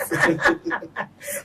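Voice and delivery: short high laugh. Real, not acted. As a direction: chuckle it off loudly. Laughs after the second demo.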